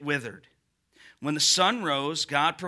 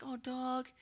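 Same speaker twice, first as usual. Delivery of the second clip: mock pitying coo; 6 semitones higher, 13 dB quieter than usual. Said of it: "she'd be like".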